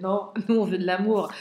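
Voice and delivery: ordinary talk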